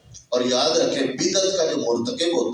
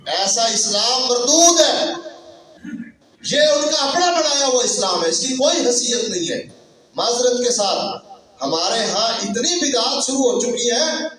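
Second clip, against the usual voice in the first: high, indignant sermon rebuke; forceful preaching; 8 semitones higher than usual. Acted. Preacher rebuking those who disfigured the faith, forceful. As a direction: denounce them with a high, insistent voice.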